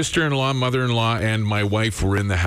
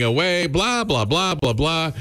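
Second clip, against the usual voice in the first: high-pitched mock chatter, blah after blah; imitating nattering; 7 semitones higher, slower than usual. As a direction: imitate relatives nattering away in a raised pitch. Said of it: "just nattering".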